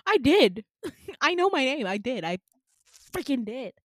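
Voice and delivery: loud, high acted protest; exasperated outburst, easing toward the end; indignant. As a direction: insist loudly you already said it. Indignant she already said her name.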